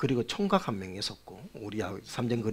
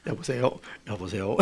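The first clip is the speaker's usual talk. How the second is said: pious phone voice; 5 semitones lower than usual. Retold or imitated. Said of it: "이제 경건한 척".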